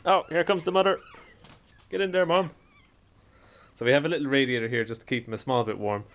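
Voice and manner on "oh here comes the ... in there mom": talking to the dog. Real, not acted. Cheering the mother dog on.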